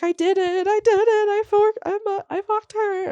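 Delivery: high, wild voice blurting out a confession; frantic confession. Acted. Acting out suspect's confession.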